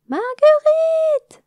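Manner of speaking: very high, loud, drawn-out calls; frantic calling. Acted. Dame Églantine running from room to room, calling the missing queen. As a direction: call her name through the hive, frantic. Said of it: "répéta Églantine".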